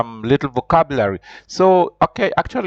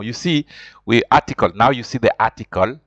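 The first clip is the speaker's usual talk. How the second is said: normal talking voice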